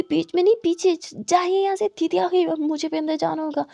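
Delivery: urgent whisper-shout to get him gone; flustered panic. Acted. Girl panics, shoos him away.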